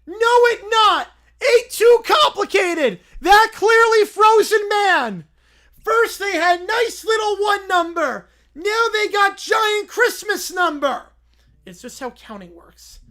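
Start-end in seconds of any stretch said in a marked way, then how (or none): 0.1-11.1 loud, high, slow caveman shout. Acted. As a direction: bellow like a dumb caveman. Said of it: "why is it talking like a caveman".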